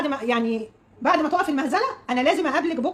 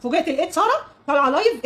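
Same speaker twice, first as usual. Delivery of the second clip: usual speaking voice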